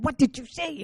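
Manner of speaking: mother repeating her angry demand; mom's angry demand. Retold or imitated. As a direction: press her hard like an angry mom.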